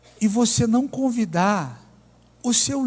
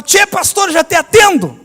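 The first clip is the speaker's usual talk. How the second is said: much higher, loud gaúcho call, sung out; ironically discreet shout; 9 semitones higher, 8 dB louder than usual. Retold or imitated. Trailer guy calls out to the pastor, a loud mock-discreet gaúcho shout. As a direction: bellow it so the whole place hears. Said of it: "daquele jeito bem, né, discreto".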